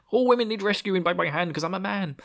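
high, hammed-up macho declaration; mock-heroic. Chauvinist hero explains his rescue, mock-heroic. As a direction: send up a self-important rescuer.

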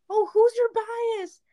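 high, slow, bright-eyed greeting; excited fangirl question. Retold or imitated. Driver calling from car window to a fan, excited. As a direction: call out eagerly to a fellow fan. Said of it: "rolled down my window to say".

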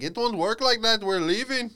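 put-on curt Indian-accented dismissal; mock Indian accent, brusque. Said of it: "the accent comes off as aggressive".

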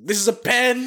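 loud mock accent with the P exploded; mock Japanese English. Cohost joking about the clip, loud mock accent. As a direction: shout "This is a pen!" with a high, punched pen. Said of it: "jab 'em with the pen".

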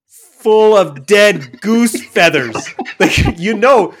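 loud, high, emphatic tirade; comic outraged rant. Real, not acted. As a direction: shout it in mock indignation. Comic rant about goose down.

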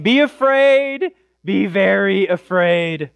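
high, slow mock warning; fearmongering world. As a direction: stretch it out like a herald of doom.

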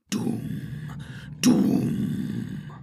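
low, long resonant boom; rolling drum imitation. A narrator's reading. Narrator voices distant orc drums, throbbing booms. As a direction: let each beat throb and ring on. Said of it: "the drumbeats throbbed and rolled".

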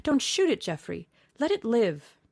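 high, loud plea to spare the hare; cry out. Acted. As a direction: shout it, begging him not to fire. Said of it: "he cried out at once".

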